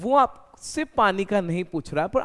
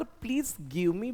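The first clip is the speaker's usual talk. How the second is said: blunt request; 6 semitones higher, 3 dB quieter, much slower than usual. Shown as a demonstration. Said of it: "तो इतना अच्छा नहीं लगेगा".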